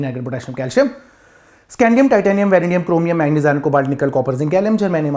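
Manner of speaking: run-on list of element names; recited from memory. Shown as a demonstration. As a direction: reel off the elements by heart. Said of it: "कसम से मैं आपको सुना सकता हूँ".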